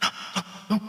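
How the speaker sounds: hushed, urgent whisper imitating a mother; voiceless coaching whisper, acted out; imitated whisper. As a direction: whisper it like a secret warning to a child. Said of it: "my mother will whisper to me".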